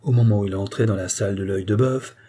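ordinary delivery, nothing marked

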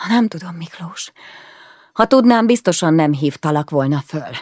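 sharp angry snap, loud at the start; snapped in frustration at husband; snapping. Acted. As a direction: bite his head off. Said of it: "Csattantam föl azonnal".

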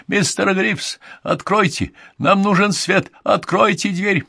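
high-pitched insistent calls; urgent calling out. A narrator's reading. Man pounding on door for the butler, urgent calling. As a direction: call through a door for help.

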